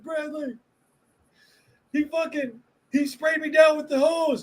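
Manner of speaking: very high mock-upset complainer; pissed-off tattling kid. Soaked kid telling on coworker to the boss, mock upset. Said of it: "He's all pissed off".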